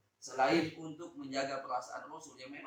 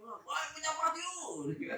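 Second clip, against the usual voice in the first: villager's remark; 12 semitones higher, much slower than usual. Retold or imitated. Villager quote in a story.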